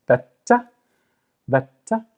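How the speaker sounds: "Batta" with the double t held before release; doubled t. Shown as a demonstration. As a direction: stress the t in "Batta" with a brief stop. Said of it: "So I know this is emphasized".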